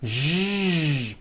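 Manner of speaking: a long buzzing "Zh" with the throat vibrating; voiced zh sound. Shown as a demonstration. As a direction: hold out the voiced zh. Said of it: "feel the vibrations right here".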